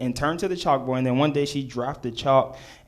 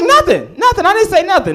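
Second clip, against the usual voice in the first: panicky denial; 7 dB louder than usual. Retold or imitated. Kid backpedaling to teacher.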